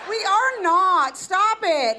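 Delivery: slow and loud, each word apart; we... are... not, loud and slow; loud slow denial. Real, not acted. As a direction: shut the joke down firmly. Denying dating the crowd member.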